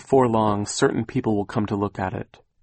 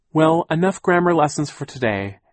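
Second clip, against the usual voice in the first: higher, louder aunt voice; Aunt Josephine; 7 semitones higher, 6 dB louder than usual. A narrator's reading. Aunt ending the grammar lesson, character voice. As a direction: lift the voice and close the subject briskly.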